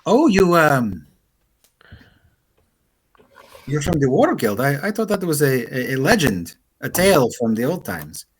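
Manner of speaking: lightly voiced, hesitant crewmate; halting, lilting character voice; Astra. Crewmate learning the captain's from War Guild, lightly voiced, hesitant. Game master plays Astra.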